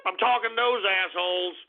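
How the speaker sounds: ordinary talk, nothing marked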